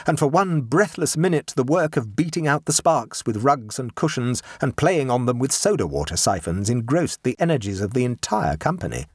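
usual speaking voice

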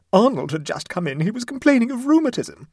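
high, loud, wailing retelling; distraught. A narrator's reading. Mother tells her tale to the neighbour, distraught. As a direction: pitch rising as the tale goes on.

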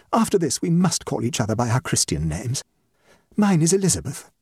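lightly voiced gushing hostess; society hostess, grateful. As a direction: play a hostess declaring new friendship.

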